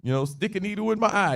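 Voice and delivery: high-pitched, hammed-up swearing of innocence; emphatic oath. Peter denying it with an oath, insistent mock protest. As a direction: swear it up and down like a kid. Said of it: "He took it to another level".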